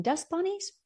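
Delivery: mock-cheerful. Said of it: "and I was thinking".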